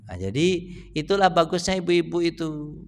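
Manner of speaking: normal talking voice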